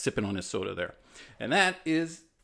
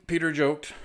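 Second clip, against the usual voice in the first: ordinary delivery, nothing marked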